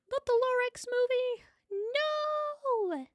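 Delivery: high squeaky mock outrage; mock shock. Streamer reacting to chat's Lorax joke with mock shock. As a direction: shriek it in fake horror.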